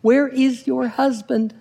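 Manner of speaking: high, drawn-out alarm message; beeper's warning message. Acted. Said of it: "It has a message flashing. It says".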